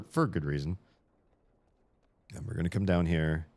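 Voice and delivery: usual speaking voice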